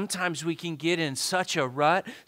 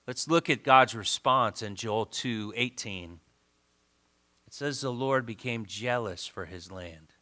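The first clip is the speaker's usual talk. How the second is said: low, subdued pulpit voice; grave, unhurried teaching with long pauses; 6 semitones lower than usual. Real, not acted. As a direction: bring it down low and let it settle. Drops to quiet preaching.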